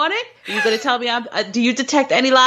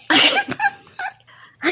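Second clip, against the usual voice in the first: laughing. Real, not acted. Flustered, laughing about the film.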